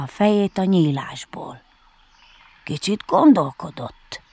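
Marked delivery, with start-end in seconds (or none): none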